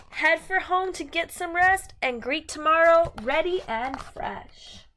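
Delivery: bright, sing-song picture book reading; reading to children. Acted. Teacher reading a rhyming book to her class, sing-song. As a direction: read the rhyme to a class, lilting and lively.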